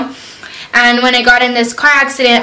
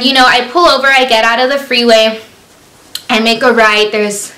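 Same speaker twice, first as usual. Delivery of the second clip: normal talking voice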